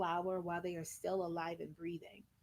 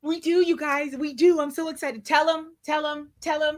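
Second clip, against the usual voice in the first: loud, high, thrilled squeal; bursting with excitement, much louder; announcing big news; 6 semitones higher, 10 dB louder than usual. Real, not acted.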